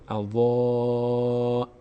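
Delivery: long, held "اضاعت" with a dark rounded vowel; full emphatic vowel. Shown as a demonstration. Teacher models the Quran word, full long vowel. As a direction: stretch "اضاعت" slowly, mouth opened upward, ending on crisp "t".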